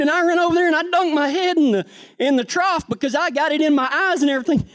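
usual speaking voice

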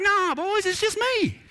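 high-pitched, easygoing retold reassurance; casual mock reassurance. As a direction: wave off their fright in a high voice. Jesus casually reassures frightened disciples.

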